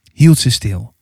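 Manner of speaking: usual speaking voice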